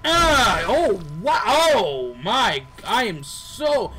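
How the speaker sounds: horrified at what you just did; real startled yelp of dismay; shocked outcry. Real, not acted. Accidentally killed the mouse.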